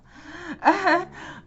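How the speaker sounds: sudden high-pitched burst of laughter. Real, not acted. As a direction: laugh out loud at your own joke. Laughs at own Rona joke.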